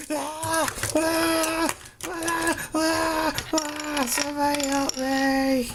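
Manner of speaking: panicked screaming. Acted. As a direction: scream in terror, slow on the plea. Mock horror skit, victim screams.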